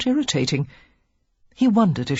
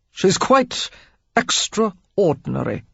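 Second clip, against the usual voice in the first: pointed, slowed sarcasm on extraordinary; a lord sneering politely about her sister; uncomplimentary; 2 semitones higher, slower than usual. A narrator's reading. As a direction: drag out the word with disapproval. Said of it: "Alex's tone was far from complimentary".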